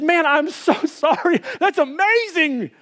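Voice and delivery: embarrassed but thrilled; sheepish delight. Retold or imitated. Apologetic yet thrilled reply.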